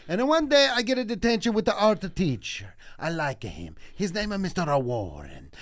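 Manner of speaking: put-on Italian storyteller accent; sad Italian. Comic telling a boyhood detention story, mock Italian accent. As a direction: melodramatic Italian accent with extra vowels between words.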